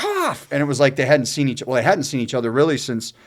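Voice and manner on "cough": very high delighted yelp of a nickname; joyful shout back. Retold or imitated. Feemster greeting his old teammate Coffey, very high gleeful shout. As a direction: squeal the nickname back in glee. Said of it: "Coffey looks at him and goes".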